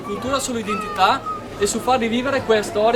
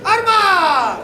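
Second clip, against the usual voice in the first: battle cry; 11 semitones higher, slower than usual. Real, not acted. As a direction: shout each command to the crew. Firing drill commands shouted.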